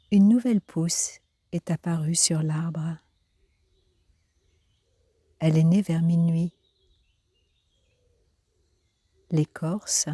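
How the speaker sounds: unhurried, carefully phrased poetry recitation; poem reading. Acted. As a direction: read the poem line by line, letting each phrase settle. Reads a poem aloud.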